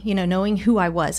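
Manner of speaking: ordinary talk, nothing marked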